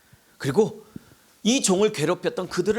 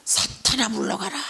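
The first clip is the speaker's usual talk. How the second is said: hoarse rebuke, little voice behind it; hammed-up prayer rebuke; mock exorcism; 5 semitones higher than usual. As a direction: banish Satan in a strained rasp. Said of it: "막 이렇게 하면서 기도를".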